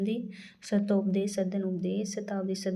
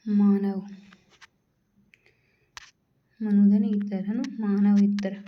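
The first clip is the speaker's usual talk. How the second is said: emphatic teacher; 5 dB louder than usual. Real, not acted. Teacher stressing the answer.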